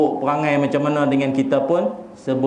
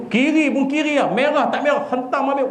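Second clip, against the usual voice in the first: higher, hammed-up urgency; mock reckless chase; 10 semitones higher than usual. Driver describing his chase after an insult, hammed-up and pitched up. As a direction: rush it like a hothead chasing a car.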